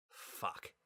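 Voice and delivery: unvoiced whisper curse. A narrator's reading. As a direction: breathe the curse silently. Inner thought.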